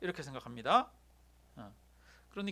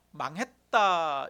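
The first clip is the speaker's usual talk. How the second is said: 망했다 stretched very slowly and louder; slow heavy pronouncement; slow emphatic verdict; 2 semitones lower, 5 dB louder, much slower than usual. Shown as a demonstration. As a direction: drag out 망했다 with weight. Preacher repeats key verdict.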